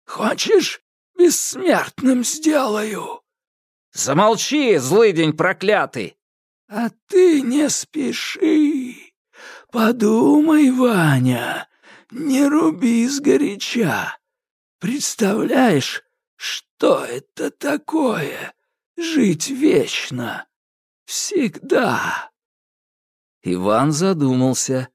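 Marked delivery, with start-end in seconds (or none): 0.1-3.2 high, slow sly whisper-like voice; tempting sorcerer. A narrator's reading. Koshchei tempts Ivan. 3.9-6.2 angry retort. A narrator's reading. Ivan curses Koshchei. 6.7-22.3 high, slow sly whisper-like voice; tempting sorcerer. A narrator's reading. Koshchei tempts Ivan.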